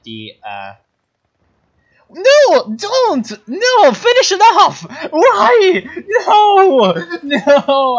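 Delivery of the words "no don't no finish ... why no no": very high, loud desperate yells; high loud yells of real dismay; frustrated shouting. Real, not acted. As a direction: scream at the screen in real frustration. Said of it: "I'm so mad".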